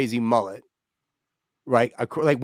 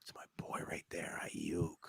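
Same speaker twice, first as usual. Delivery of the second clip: hushed whisper; 10 semitones lower, 21 dB quieter than usual. Real, not acted. Quiet pick in live game.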